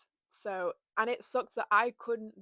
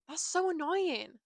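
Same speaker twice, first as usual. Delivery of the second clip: slow, higher-pitched exasperation; exasperated; 8 semitones higher, 4 dB louder, slower than usual. Real, not acted. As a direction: draw out the complaint, pitched up. Venting at own forgiving nature.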